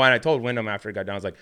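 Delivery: ordinary delivery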